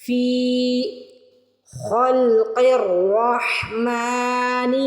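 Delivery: each word recited very slowly, set apart; slow tajweed drill; slow recitation drill. Shown as a demonstration.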